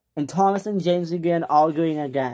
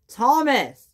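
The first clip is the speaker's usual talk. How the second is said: yelled name; 9 semitones higher, 3 dB louder than usual. Acted. Angry call in quarrel.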